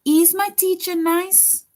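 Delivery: high rising question on "nice"; question tune. Shown as a demonstration. Teacher reading a question aloud to the class, rising tune. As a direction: voice climbs up to "nice?" like a real question. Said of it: "it ends with a question mark".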